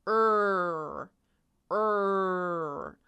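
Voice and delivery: "er" held long and loud; scrunched-lip, drawn-out "er"; exaggerated ER sound. Shown as a demonstration. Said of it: "look at me when I say that sound".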